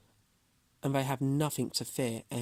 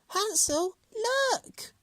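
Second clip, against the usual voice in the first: put-on high excited girl's call; excited cry; 3 dB louder, much slower than usual. A narrator's reading. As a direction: call out to her brother, thrilled. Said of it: "cried Gretel".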